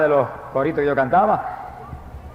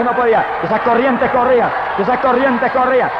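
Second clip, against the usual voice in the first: high-pitched, driving sermon delivery; urgent, repeated testimony at full force; fervent preaching; 6 semitones higher, 3 dB louder than usual. Real, not acted. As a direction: preach it with mounting fervor.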